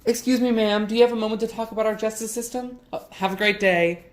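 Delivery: high, relentlessly upbeat petition pitch; chipper street canvasser. Acted. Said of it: "he's got this huge grin plastered".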